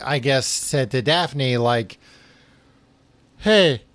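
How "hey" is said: yawning mid-word. Real, not acted. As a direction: speak through a yawn. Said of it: "Sorry, I yawned".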